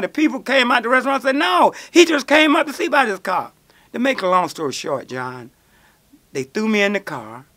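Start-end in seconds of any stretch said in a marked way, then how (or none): 1.4-3.5 higher, louder protest; crowd protesting. Retold or imitated. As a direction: bystanders defending him. Bystanders defending him.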